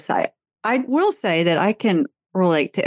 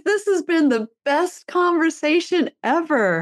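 higher, delighted daughter's voice; imitating daughter; 9 semitones higher than usual. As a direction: gush happily like the daughter. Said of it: "she goes".

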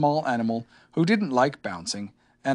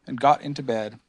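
ordinary delivery

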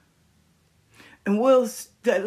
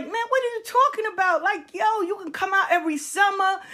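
high and fast, pleading disbelief; Will pleading with his friend; pleading young Will; 11 semitones higher, faster than usual. Retold or imitated. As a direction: beg him like a confused kid. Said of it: "he's looking like".

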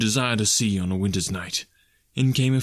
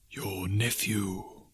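ghost voice; 4 semitones lower, 9 dB quieter, much slower than usual. Acted. Ghost, slow and soft.

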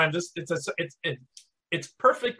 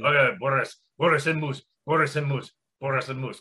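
Boris cartoon accent; 6 semitones lower, faster than usual. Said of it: "Good accent work".

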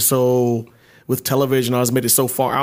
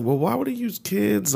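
high, drawn-out puzzled question; slow it down, mock-baffled and high; retold puzzlement; 9 semitones higher, 4 dB quieter, slower than usual. Host recalls doubting the use of kids, retold puzzlement. Retelling own earlier puzzlement.

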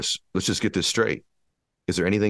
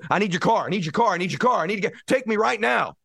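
mock frantic plea. Begging to borrow a car.

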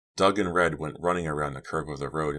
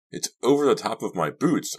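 high, louder exclamation; crying out; 7 semitones higher, 5 dB louder than usual. A narrator's reading. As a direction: call out in dismay, voice lifted. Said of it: "I cried".

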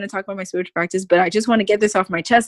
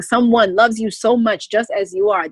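normal talking voice

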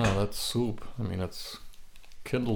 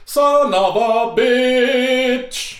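long strained high yell; 8 dB louder, much slower than usual. Acted.

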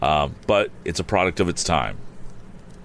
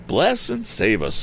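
high, slow cry of dismay; alarmed cry; 8 semitones higher, slower than usual. A narrator's reading. Housekeeper hears of an accident, crying out in alarm. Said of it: "cried Missus Baggert".

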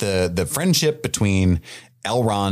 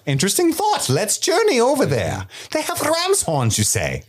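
mock the refined elf musing aloud; posh Celebrimbor impression. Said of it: "He's like".

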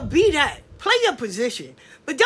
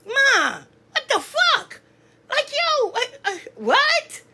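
much higher, stunned what the fuck; can't believe what she's hearing; squeaky high disbelief; incredulous; 11 semitones higher, slower than usual. Real, not acted. Incredulous outburst at cowards.

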